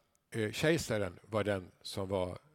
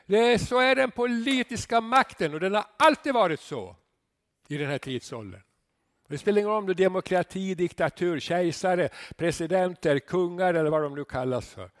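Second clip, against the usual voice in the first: louder, higher, fast rant; fiery preaching; 6 semitones higher, 8 dB louder than usual. Real, not acted. Pastor railing against politics from the pulpit, loud and fast. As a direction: preach it hard against worldly power.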